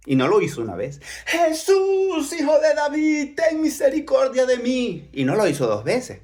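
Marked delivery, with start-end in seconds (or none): 1.3-5.1 high, long-held plea for mercy; urgent beggar's shout. Acted. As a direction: cry out to be heard over the crowd. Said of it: "Dice que dio voces".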